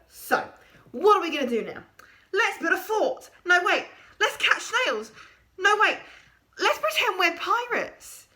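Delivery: eager creature. A narrator's reading. Creature proposing games to Stitchhead, bubbly excitement. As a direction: bounce from idea to idea.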